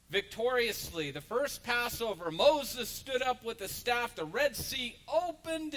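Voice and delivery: loud, high, rising sermon voice; fired-up pulpit storytelling; preaching at full force. Acted. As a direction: preach it out to the congregation. Pastor preaching the Exodus story.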